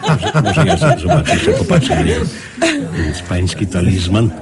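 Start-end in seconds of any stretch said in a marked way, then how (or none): 0.1-2.2 excited, fast and high with laughter; eager giggle. Acted. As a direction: grab the chance to look, laughing. Eager to look at ring.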